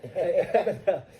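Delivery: a loud burst of laughter. Real, not acted. Guest bursts out laughing at the host's teasing.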